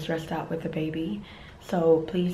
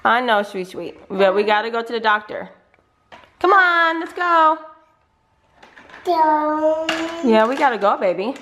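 loud, higher-pitched parental coaxing; 7 semitones higher, 8 dB louder than usual. Real, not acted. Mother urging toddler off to the doctor, coaxing baby talk. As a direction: urge a reluctant little one along.

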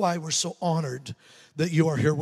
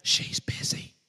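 put-on little boy's hushed whisper; slower than usual. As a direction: whisper secretly into the phone. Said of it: "came back on the phone whispering".

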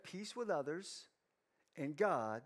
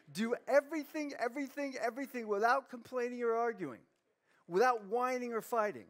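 "everything" pitched high and repeated insistently; emphatic repetition; 6 semitones higher than usual. Real, not acted. Preacher driving home Paul's clear message, emphatic. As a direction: hammer each "everything", higher than usual.

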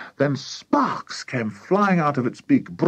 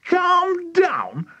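very high, incredulous outburst; indignant cry. A narrator's reading. Mister Cranky answers his wife, indignant high cry. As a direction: squawk it back in disbelief. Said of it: "cried Mister Cranky".

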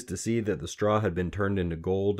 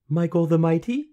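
put-on high, louder questioning girl; tentative guess; 11 semitones higher, 8 dB louder than usual. A narrator's reading. Queen guessing the little man's name, tentative. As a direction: try the name out, pitched right up.

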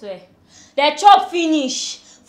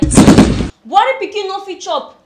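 louder, higher, drawn-out indignant protest; 5 semitones higher, 9 dB louder, slower than usual. Acted. House help objects to madam about washing plates, loud protest. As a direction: protest slowly at raised volume.

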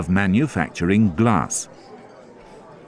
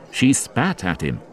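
ordinary delivery, nothing marked

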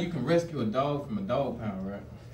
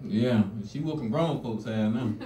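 ordinary talk, nothing marked

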